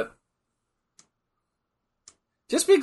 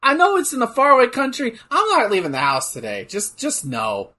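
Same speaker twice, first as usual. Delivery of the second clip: imitated self; 9 semitones higher, 3 dB louder than usual. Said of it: "you're just like".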